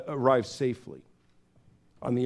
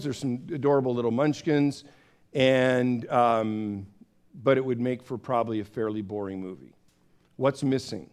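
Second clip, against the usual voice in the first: ordinary delivery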